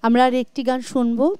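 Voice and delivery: ordinary delivery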